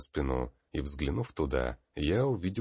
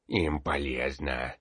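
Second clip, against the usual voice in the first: put-on old man speaking with a grunt; creaky old peasant, grunting; groaning old man; slower than usual. A narrator's reading.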